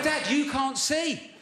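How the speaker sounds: high, slow, drawn-out protest; exasperated protest. Acted. Comic protesting to his bandaged dad, acted exasperation. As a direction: plead incredulously, pitch leaping up. Acted quarrel over parking.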